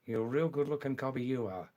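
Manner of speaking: fast, slurred-together Australian; original Australian accent. Shown as a demonstration. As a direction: rattle it off like an Aussie mate. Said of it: "In original Australian".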